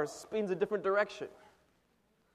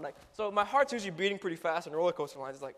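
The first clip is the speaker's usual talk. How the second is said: normal talking voice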